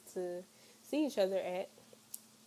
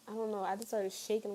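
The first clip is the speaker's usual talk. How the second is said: ordinary talk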